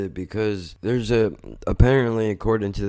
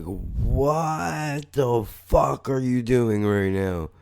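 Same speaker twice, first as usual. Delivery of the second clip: retold disbelief; 4 semitones higher, much slower than usual. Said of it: "I was just like".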